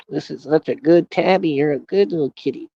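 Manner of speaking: sweet talk to a cat. Real, not acted. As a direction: fuss over the tabby. Baby talk to his cat.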